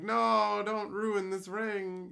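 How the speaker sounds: high, drawn-out mock wail; mock pleading crowd. Imitating the community begging to save the ring, mock anguish. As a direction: plead in mock horror, loud at first.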